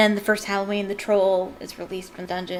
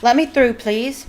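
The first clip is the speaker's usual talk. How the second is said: Percy pushing through; 3 semitones higher, 7 dB louder than usual. Acted. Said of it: "came Percy's voice".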